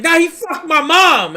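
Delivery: high, loud accusation; high loud protest about the cop; emotional outburst. Retold or imitated. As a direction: blurt it out high and hurt.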